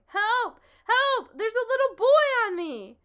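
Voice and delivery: loud, high cries for help; shouting for help. Acted. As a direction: call out pinned under the boy. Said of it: "lay there shouting".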